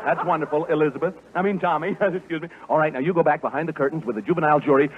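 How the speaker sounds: ordinary talk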